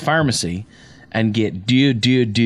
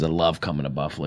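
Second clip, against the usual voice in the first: much lower and softer mock Berman voice, fast; mocking Chris Berman; 8 semitones lower, 7 dB quieter, faster than usual. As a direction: do a dopey low Berman impression. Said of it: "in his stupid accent".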